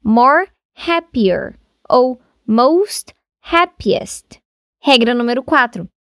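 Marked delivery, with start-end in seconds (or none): 0.0-4.4 slow, deliberate example of the wrong form. Shown as a demonstration. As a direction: spell out the mistake slowly. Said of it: "Você nunca vai dizer que". (exemplo errado dito devagar)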